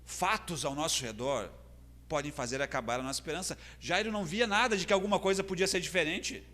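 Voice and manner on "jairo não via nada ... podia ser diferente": raised, urgent sermon delivery; impassioned preaching. Acted. Preacher recounting Jairo's fading hope to the congregation, impassioned. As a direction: build the momentum of a preacher.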